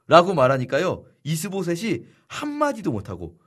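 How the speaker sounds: ordinary talk, nothing marked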